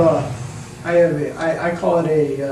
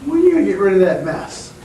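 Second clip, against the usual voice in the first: exasperated complaint; 4 semitones higher, 6 dB louder, much faster than usual. Retold or imitated. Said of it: "and I says".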